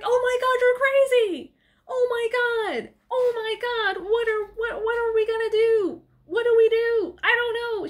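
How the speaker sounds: high, wailing hammed-up panic; mock panic. [name] freaks out at Maya over the head, mock panic.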